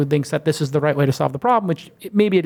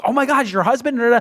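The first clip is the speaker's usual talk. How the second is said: mock surprise; 8 semitones higher than usual. Uber driver's astonished reply.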